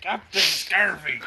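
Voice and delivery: loud, slow pirate call. Pretend. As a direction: announce it like a pirate captain. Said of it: "sound kinda like a pirate name".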